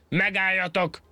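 high, furious shout to stop them; furious roar. Acted. Angry monkey bellows at the fleeing pair. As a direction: bellow after them in rage. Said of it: "elordította magát".

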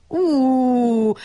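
long high wailing "ooh"; drawn-out mock wail; howl. A narrator's reading. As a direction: hoot it out like a howl, sustained. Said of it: "tutult pálinkós Gyurka".

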